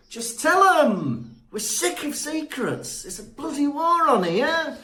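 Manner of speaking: high-pitched, indignant mock outburst; exasperated rant. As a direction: cry out, fed up with all the secrecy. Exasperated rant at Harry's secrecy.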